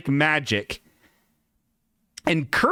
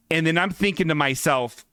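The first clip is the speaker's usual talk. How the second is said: ordinary talk, nothing marked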